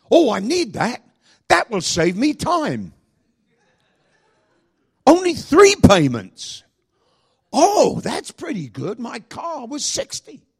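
high, loud, drawn-out wonder; hammed-up gullible awe, pitched high; gullible buyer. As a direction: slowly marvel like an easily sold TV shopper. Acts out gullible TV-shopping buyers.

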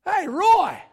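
loud, high Australian shout; calling out. Acted. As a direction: holler as the Australian evangelist across the room. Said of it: "all of a sudden he goes".

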